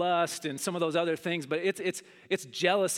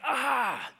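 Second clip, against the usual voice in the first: frustrated yell; 6 semitones higher than usual. Retold or imitated. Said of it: "I was like".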